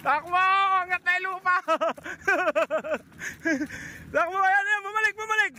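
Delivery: out of breath, shouting while running. Real, not acted. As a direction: yell while chasing on foot. Chasing bird, running and laughing.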